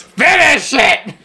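slow, growling villain voice; Willem Dafoe voice. Retold or imitated. Green Goblin voice done for a friend, slow growl. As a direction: drag out the command like Willem Dafoe. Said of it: "good Willem Dafoe voice".

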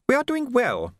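high, composed society lady; a poised hostess, lightly voiced; genteel lady voice. A narrator's reading. Madam's voice in story.

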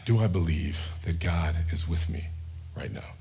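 a private question breathed out; whispered doubt. Acted. Honest question of faith to congregation.

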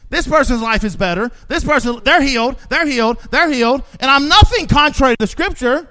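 ordinary talk

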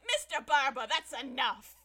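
loud, scolding reprimand; judge yelling. Retold or imitated. Judge yelling at Barba in court, loud reprimand. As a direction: call him to order, loudly. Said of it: "the judge starts yelling at Barba".

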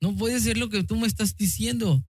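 understated shock, quoted from memory; retold shocked disbelief. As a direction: lift the pitch in mild astonishment, keeping it soft. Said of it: "con una cara sorprendida".

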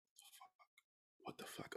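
whispered, slow and unvoiced; under my breath. Scared in the car to the hotel, whispering to himself. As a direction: breathe the words out scared, no voice at all. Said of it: "Under my breath almost the entire time".

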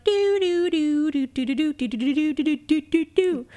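sing-song do-do mimicking a trumpet; mock trumpet riff. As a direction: hum it like a brassy fanfare. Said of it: "trumpet thing where it's like".

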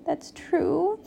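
high, soft, tearful admission; small weepy voice; sniffling. A narrator's reading. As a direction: concede it softly through sniffles. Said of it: "sniffled Pig".

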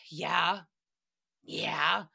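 imitating a huge sigh into a low "yeah"; mock-weary, low-pitched agreement; exasperated sigh. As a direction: sigh heavily, then give a low, reluctant yes. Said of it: "he let out the biggest sigh".

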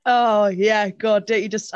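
exaggerated "Oh, yeah" in retold sarcasm; sarcastic agreement. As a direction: play along sarcastically. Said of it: "I was sort of being all sarcastic".

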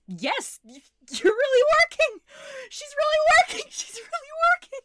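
the creator sobbing with joy that it finally works; overjoyed, near tears. Acted. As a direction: burst out in tearful disbelief. Creator sees AI work.

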